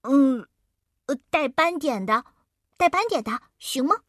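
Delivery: put-on high, unsure little boy; hesitant child. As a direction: ask shyly, voice going up. Leo hesitantly asks. (犹豫的小男孩声音)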